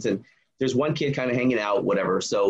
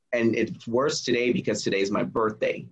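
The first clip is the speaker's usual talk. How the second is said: plain reading voice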